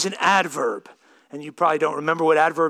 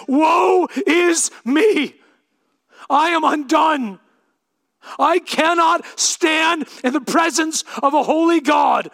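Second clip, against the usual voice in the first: shouted woe; 10 semitones higher, 5 dB louder than usual. Acted. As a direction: cry out in terror before God. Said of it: "and he says, shout".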